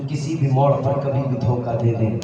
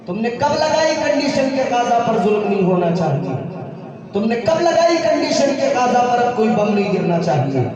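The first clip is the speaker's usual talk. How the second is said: raised pitch, mounting insistence; impassioned preaching challenge; 8 semitones higher than usual. Real, not acted. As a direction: hurl the question like an accusation, climbing higher. Challenging rhetorical questions in sermon. (ललकारते हुए ऊँचे सुर में सवाल)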